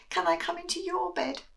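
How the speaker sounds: small high mouse voice; high squeaky little mouse asking. Acted.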